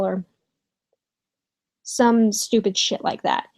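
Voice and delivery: usual speaking voice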